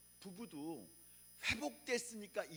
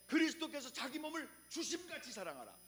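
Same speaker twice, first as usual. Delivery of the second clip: scripture quote; 9 semitones higher than usual. Acted. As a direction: proclaim the verse. Quoting verse.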